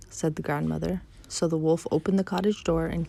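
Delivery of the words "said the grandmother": ordinary delivery, nothing marked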